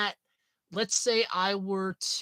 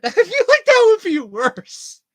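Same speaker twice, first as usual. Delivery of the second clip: high, loud words through real laughter; barely getting it out between laughs; laughing; 13 semitones higher, 11 dB louder than usual. Real, not acted. Laughing at the place name, high and loud.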